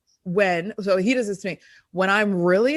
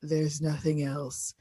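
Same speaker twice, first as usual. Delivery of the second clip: slow, hollow, hammed-up misery; mock gloomy despair; 4 semitones lower, slower than usual.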